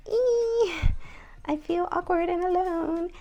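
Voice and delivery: high, squeaky anxious inner voice; awkward squeak. Retold or imitated. Vlogger voicing her church anxiety in a high squeak. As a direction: play her own nervous self, squeaking.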